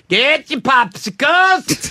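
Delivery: slow drawn-out vendor holler; vendor call. Retold or imitated. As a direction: call it out like a stadium hawker. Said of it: "they like my call to action".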